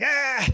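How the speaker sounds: high-pitched imitated howl of laughter. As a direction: howl like the joker laughing at himself. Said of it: "just howling".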